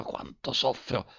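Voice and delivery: a comic sigh trailing off; fading lament. A narrator's reading. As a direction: let the lament fade almost to nothing, slowly. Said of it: "Ah, giornalino mio".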